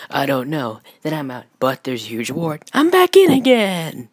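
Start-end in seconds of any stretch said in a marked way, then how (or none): 2.7-4.1 high, loud burst of enthusiasm; excited shout. Acted. Deadpool rejoining for a huge reward, excited shout. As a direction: shout it out, thrilled.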